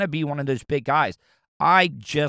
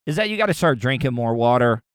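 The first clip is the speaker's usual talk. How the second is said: ordinary delivery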